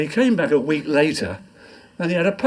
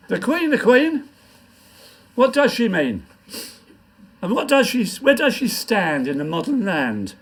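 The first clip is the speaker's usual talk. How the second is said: poem recitation; 4 semitones higher than usual. Acted. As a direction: declaim it to the pub crowd. Recites his comic poem.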